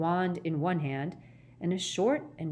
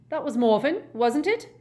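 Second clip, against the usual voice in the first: high, louder, pressing question; acted pointed official; 8 semitones higher, 7 dB louder than usual. Ogden asking Gaunt about his son, pointedly.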